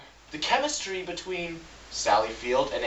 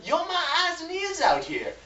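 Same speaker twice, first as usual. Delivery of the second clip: much higher, strained Jamie Foxx impression. Reviewer tries Andrew Garfield's line but sounds like Jamie Foxx. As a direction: pitch way up like Jamie Foxx's villain. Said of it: "you just sounded like Jamie Foxx".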